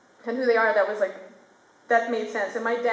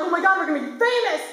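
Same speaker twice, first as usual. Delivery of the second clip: put-on higher, thrilled kids' voices; excited kids; 7 semitones higher than usual. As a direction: squeal with childish hope.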